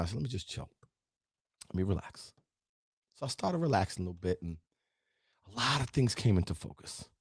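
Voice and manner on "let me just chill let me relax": soft, low self-soothing; calming down. Retold or imitated. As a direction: talk yourself down quietly. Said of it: "I started calming down".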